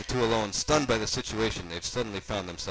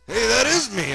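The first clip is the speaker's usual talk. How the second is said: high, loud, thrilled uncle; boastful cartoon uncle; Uncle Creepy character; 13 semitones higher, 10 dB louder than usual. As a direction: exclaim it proudly, pitched way up. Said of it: "Uncle Creepy said".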